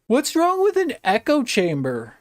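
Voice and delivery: high whiny mock voice of critics; mocking quote. Streamer mocking chat critics, high whiny voice. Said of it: "Then they're like".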